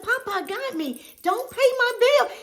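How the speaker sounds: flustered protest; high protest. Retold or imitated. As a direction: insist she shouldn't. Refusing paid bill.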